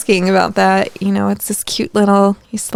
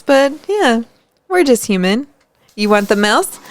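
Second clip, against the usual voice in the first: normal talking voice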